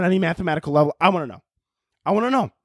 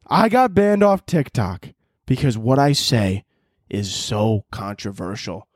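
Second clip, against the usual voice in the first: ordinary talk, nothing marked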